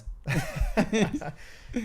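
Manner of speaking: a burst of high laughter. Real, not acted. Co-host laughing after the caller loses, a high laugh. Reacting to caller's wrong answer.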